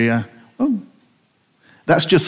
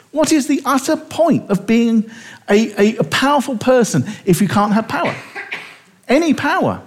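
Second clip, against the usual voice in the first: mock indignation; 6 semitones higher than usual. Mock indignant rhetorical questions.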